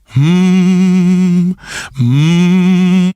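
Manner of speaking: low buzzing hum; resonant hum. Acted. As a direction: hum so the lips vibrate. Humming into his hand.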